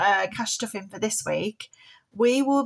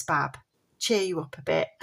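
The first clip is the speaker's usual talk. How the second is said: ordinary talk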